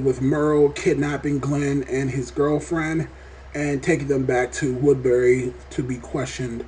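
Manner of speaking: ordinary delivery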